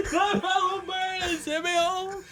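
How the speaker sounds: high laughing voice breaking into giggles. Real, not acted. Cracking up at his friend's story, laughing hard. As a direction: squeal it through real laughter.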